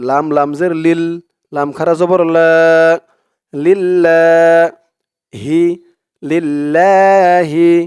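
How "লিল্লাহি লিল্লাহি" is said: লিল্লাহি with a held, doubled lam, slow; slow recited লিল্লাহি. Shown as a demonstration. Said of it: "তাসদিদ ওলা লাম ধরবে".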